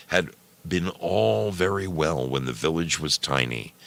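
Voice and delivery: ordinary delivery, nothing marked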